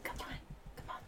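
barely audible coaxing; sneaky coaxing. Real, not acted. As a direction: coax the dog along without a sound. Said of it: "do a quick sneaky sneak".

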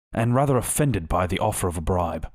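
ordinary delivery, nothing marked

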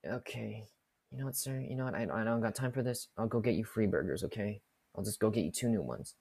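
soft, low-energy giving in; resigned placating. Retold or imitated. Cashier giving in to customer, quietly resigned. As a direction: quietly give up and placate him.

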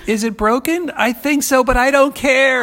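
mock fan dialogue.

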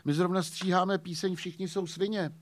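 excited chatter. Retold or imitated. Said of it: "jsem mu říkal".

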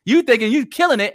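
ordinary delivery

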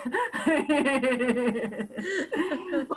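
long real laugh. Real, not acted. Guest laughs at her own impression. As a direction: burst into laughter.

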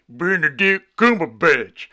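loud, drawn-out name with heavy emphasis; big, slow, exaggerated billing; mock-grand name announcement. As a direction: boom out each syllable slowly.

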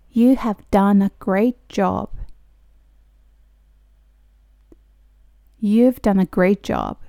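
slow, careful teacher pronunciation; slow model reading. Shown as a demonstration. Teacher reading slowly for students to repeat. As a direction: spell each word out slowly for learners. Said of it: "私と一緒に発音してもらったら".

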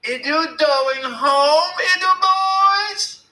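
put-on high, loud, drawn-out baby talk; shrill, girlish voice. A narrator's reading. Billy yelling after the boys, shrill and girlish. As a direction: stretch the taunt in a girlish squeal. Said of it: "girlish voice".

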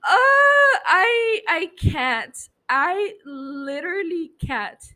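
real choked cry, breaking high then fading; can't get the words out through tears; emotional breakdown trailing off; overwhelmed crying. Real, not acted. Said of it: "I'm crying again".

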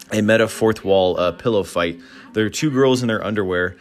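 ordinary talk

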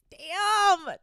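a high, loud, falling "Damn!". Real, not acted. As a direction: blurt it out, stunned. Reacting to a gross confession.

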